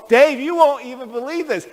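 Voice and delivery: high-pitched imitation of breaking news; urgent, hurried excitement. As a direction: blurt it out high to a friend. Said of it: "so we're talking real fast".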